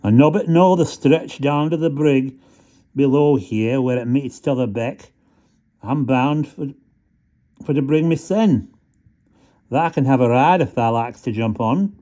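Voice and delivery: friendly country rat in broad Yorkshire. Acted. Rat offering Stumps a ride, in broad Yorkshire. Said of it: "more phonetic voice for him".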